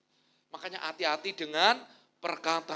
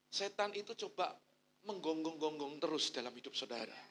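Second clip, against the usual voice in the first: ordinary talk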